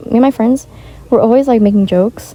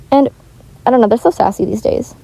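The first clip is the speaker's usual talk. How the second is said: ordinary delivery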